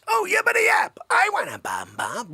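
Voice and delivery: put-on high, loud squeal of a kid wanting a treat; excited little kid. Little kid spotting the ice cream truck, a loud, high squeal. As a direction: shout it like a thrilled child. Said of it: "Little kid goes".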